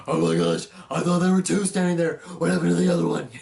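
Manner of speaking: loud, panicked gamer yell, fast on the middle line; a YouTuber screaming at Five Nights at Freddy's, mock yell; imitating Markiplier yelling. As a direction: shout it like a jump scare just got you. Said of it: "Just him yelling all the time".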